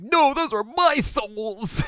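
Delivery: high, loud, drawn-out protest; mock indignant yell. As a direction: wail it possessively, stretching each word. Heroine protests stolen souls.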